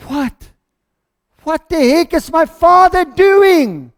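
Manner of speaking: acted outrage, building to loud and high; incredulous outburst, slow and drawn out; fuming. Older brother hearing of the party for his brother, fuming. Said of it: "He is fuming".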